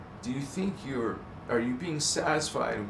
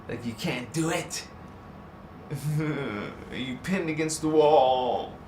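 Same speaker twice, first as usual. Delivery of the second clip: ordinary talk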